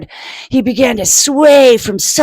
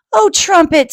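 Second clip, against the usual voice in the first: pleading mother; 8 semitones higher, 5 dB louder than usual. Acted.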